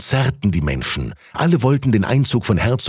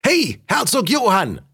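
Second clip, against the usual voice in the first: calling from crowd; 12 semitones higher than usual. A narrator's reading. Someone in the crowd hails Duke Johann, high calling voice. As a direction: call out to someone passing, pitched way up. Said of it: "rief jemand aus dem Publikum".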